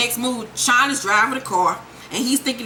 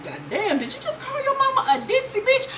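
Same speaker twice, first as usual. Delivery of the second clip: much higher, laughing in shock; laughing disbelief; 12 semitones higher than usual. Real, not acted. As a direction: squeal the question through laughter. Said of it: "I was like".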